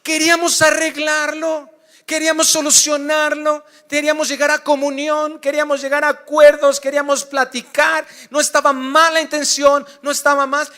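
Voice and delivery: high, loud, fervent preaching; preaching at full force. Acted. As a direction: drive it home to the congregation.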